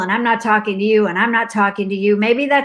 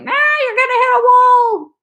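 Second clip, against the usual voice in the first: high, wailing panic; hammed-up high-pitched alert; alarm warning; 4 dB louder, slower than usual. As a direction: cry a frantic warning as the car alarm. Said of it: "Red lights, like".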